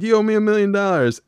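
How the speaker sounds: put-on high, loud kid voice; Bobby's claim. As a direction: demand it like a kid. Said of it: "He's like".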